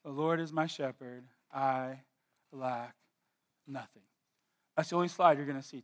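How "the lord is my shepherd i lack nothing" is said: soft, reverent reading. Acted. Reads slide verse softly.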